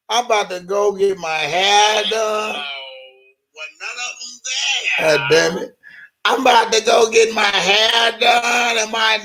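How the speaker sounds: high, shouty mock women's phone chat; gossiping women. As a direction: play both gossiping sisters. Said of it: "Angie Walker on the respond".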